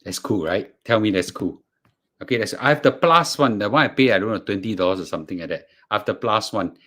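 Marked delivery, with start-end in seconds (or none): none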